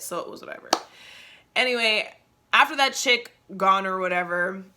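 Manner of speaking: normal talking voice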